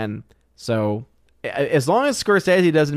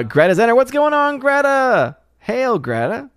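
high squeaky kitty voice; cat voice; 11 semitones higher, 3 dB louder than usual. Acted. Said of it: "And yes, I am doing the cat voice".

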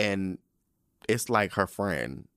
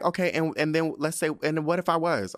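higher-pitched, acted so-what retort; defiant comeback; 6 semitones higher than usual. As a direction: play at brazenly challenging a best friend. Acting out her defiant comeback.

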